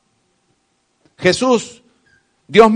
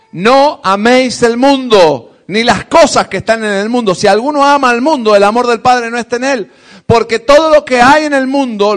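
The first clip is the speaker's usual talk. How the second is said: scripture reading; 6 dB louder than usual. Acted. Preacher reading First John aloud to the church, forceful. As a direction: proclaim the verse with force. Dice la palabra del Señor.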